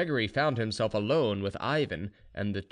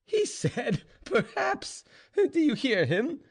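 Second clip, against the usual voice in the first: very high, drawn-out hooting derision; laughing mockery; 3 dB louder, slower than usual. A narrator's reading. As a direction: crow it very high, as if helpless with laughter. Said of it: "laughing louder than ever".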